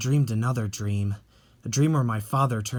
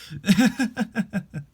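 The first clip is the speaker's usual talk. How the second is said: amused laugh; 8 semitones higher, 3 dB louder than usual. Acted. Family teasing each other.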